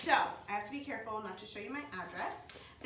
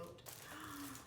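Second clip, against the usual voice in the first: soft gasp; 5 semitones higher, 14 dB quieter than usual. Real, not acted. Discovering more gifts in box.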